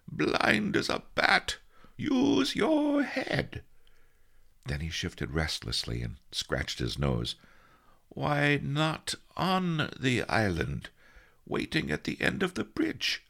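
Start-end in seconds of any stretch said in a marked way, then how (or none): 0.1-3.6 slow, high, strained scolding; cross sleep-talk in a high, forced voice; muttering in sleep. A narrator's reading. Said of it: "he muttered". 8.1-13.2 high, strained dream-grumble; irritable high-voiced sleep-talk; angry muttering. A narrator's reading. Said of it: "he muttered angrily".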